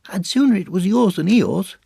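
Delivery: very high, small piping voice; Piglet. Acted. Piglet siding with Pooh, high-pitched. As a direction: pipe up to a friend.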